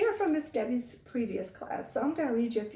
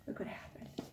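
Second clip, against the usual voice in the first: breathy story whisper; spooky whisper; 13 dB quieter than usual. A narrator's reading. Teacher whispers the ghost story's twist to the kids, spooky whisper. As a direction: hush it like a secret.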